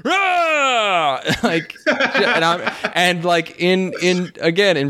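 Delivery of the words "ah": high piercing shriek imitating a little boy; kid's shriek. Imitating a boy screaming at nothing. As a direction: shriek like a screaming five-year-old. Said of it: "just going".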